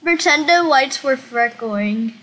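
ordinary talk, nothing marked

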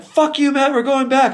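high, hammed-up mock outrage; mock angry bandmates. Band telling producer off, mock angry and high. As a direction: fake the band snapping at him. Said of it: "you guys are like".

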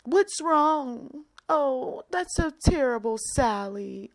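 high, sing-song fussing over a sulker; hammed-up cooing sympathy; mock coddling. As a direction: coo at a pouting adult. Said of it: "I'm just making up names".

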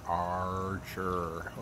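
Archer with the tongue down and back; tongue pulled back for the R in Archer; English R. Shown as a demonstration. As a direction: Archer said with a dark American R. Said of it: "my name is Archer".

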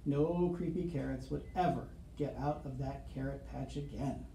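usual speaking voice

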